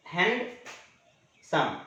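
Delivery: Handsome slowly, halved by a clear pause; handsome broken into two beats for learners; split into syllables. Shown as a demonstration. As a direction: say hand, wait, then सम. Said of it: "दो बार में बोलाता है".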